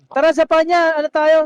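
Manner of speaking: loud team rally call; rallying shout. Real, not acted. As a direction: pump the squad up, loudly.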